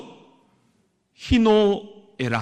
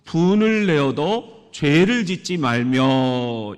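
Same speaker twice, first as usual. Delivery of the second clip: slow, louder proclamation of the verse; scripture read aloud; 3 semitones lower, 6 dB louder, slower than usual. Shown as a demonstration. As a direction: read the verse slowly for all to join. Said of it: "시작". (천천히 힘주어 성경 낭독)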